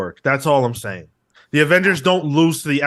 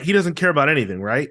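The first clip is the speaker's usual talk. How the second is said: ordinary talk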